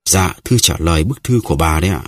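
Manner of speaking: ordinary delivery, nothing marked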